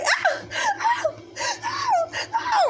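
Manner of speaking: very high hammed-up crying yelps; mock crying cries. Narrator imitates herself crying while her brother hits her on the stairs, high yelps. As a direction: wail like you're being whooped up the stairs. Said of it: "running up the stairs crying".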